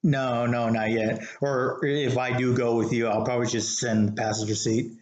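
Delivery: usual speaking voice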